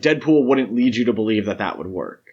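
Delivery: normal talking voice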